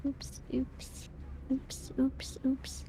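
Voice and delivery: breathy whisper, repeating softly. Real, not acted. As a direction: whisper to yourself. Fumbling, whispering to herself.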